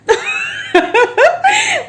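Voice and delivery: high, loud burst of real laughter. Real, not acted. Vlogger cracking up over the tailor's words, loud laugh.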